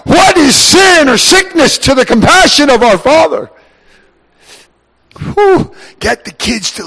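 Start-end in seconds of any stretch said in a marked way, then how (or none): 0.1-3.5 high, loud ringing cry; shouted proclamation. Acted. Preacher voicing Jesus with a triumphant shout. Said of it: "And he's like". 5.2-5.7 emotional whew. Real, not acted.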